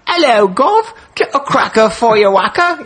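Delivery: high-pitched, hammed-up Cockney; bad Cockney accent. Shown as a demonstration. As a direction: do an Australian accent that slips into Cockney. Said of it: "a Cockney accent".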